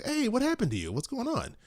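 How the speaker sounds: imitating a casual question to a limping stranger; high mock concern. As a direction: chirp the question, not too serious. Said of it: "I saw them".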